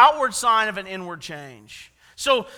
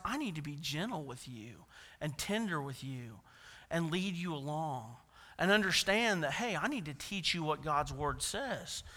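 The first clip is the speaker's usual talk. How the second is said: soft gentle reassurance; tender; 7 semitones lower, 11 dB quieter than usual. Real, not acted. Pastor to new believers, tender and soft. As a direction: lower voice, gentle and kind.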